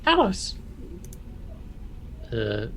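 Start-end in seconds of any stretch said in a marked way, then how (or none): 0.1-0.6 high-pitched, spat-out name. Acted. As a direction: spit the name out sharply. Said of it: "Scroller kinda spits out".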